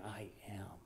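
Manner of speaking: a barely voiced whisper. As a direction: let the name fade to breath. Voicing God to Moses.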